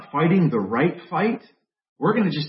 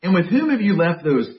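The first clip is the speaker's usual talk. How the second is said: a mild mock rebuke from an older brother, louder toward the end; angry older brother; 4 semitones higher, 6 dB louder than usual. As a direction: scold the kid brother, half-acted. Preacher voicing angry older brother.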